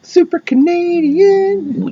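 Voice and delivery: very high, stretched mock Canadian accent; hammed-up Canadian drawl. Teasing the storyteller about her accent, mock Canadian drawl.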